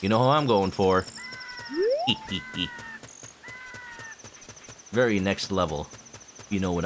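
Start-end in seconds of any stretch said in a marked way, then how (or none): 1.1-2.7 giggly squeal. Real, not acted. Squeal after bachelorette joke.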